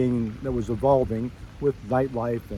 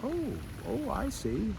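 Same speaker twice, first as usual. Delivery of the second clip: dawning realization; 6 semitones higher, 8 dB quieter than usual. Retold or imitated. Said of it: "And I went".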